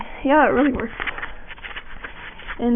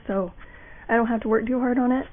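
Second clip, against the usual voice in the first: normal talking voice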